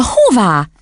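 put-on high, startled child's question; astonished little girl; cried question. A narrator's reading. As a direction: ask it as an exclamation. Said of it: "kiáltott Matilda".

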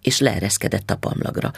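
ordinary delivery

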